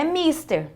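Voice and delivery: mister wrongly stressed on its first syllable, MIS; misplaced stress. Shown as a demonstration. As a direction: put the accent on the i of mister. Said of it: "coloca um acento agudo aqui no i".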